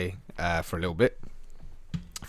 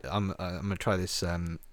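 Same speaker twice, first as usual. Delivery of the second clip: ordinary talk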